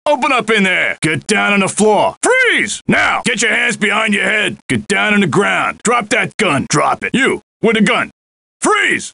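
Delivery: sharp shouted police commands; yelling cop. Acted. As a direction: freeze them with a yell. Cop bursting in, yelling orders.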